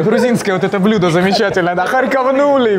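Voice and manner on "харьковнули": Харьковнули shouted way up high; high excited exclaim. Acted. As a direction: belt it out with glee. Repeated joke word, shouted high.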